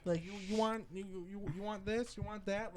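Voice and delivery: high-pitched offering voice; imitated grandmother. Grandma offering food when he comes home, high eager voice. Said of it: "she'd be like".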